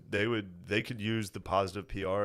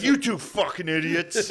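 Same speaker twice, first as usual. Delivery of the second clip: higher-pitched mock rant through laughter; imitated angry scolding; 6 semitones higher, 4 dB louder than usual. Son imitating Phil scolding him and mom, mock anger. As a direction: scold the pair in mock fury. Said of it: "He was furious".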